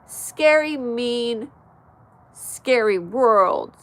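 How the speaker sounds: drawn-out, loud, overblown delivery; slow hammed-up lament about a scary world; mock dramatic. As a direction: play up the fear for sympathy. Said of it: "I was being dramatic".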